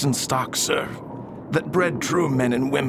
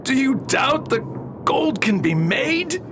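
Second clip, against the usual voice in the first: much higher, offended challenge; indignant; 9 semitones higher, slower than usual. Acted. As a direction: bristle at the doubt. Doctor doubts alchemy.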